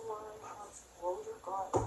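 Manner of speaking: strained through a cramp; pushing words out while cramping; stomach cramps. Real, not acted. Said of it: "I'm cramping right now".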